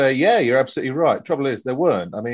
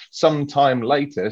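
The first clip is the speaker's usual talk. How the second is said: ordinary talk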